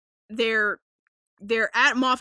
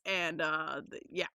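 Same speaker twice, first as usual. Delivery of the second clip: normal talking voice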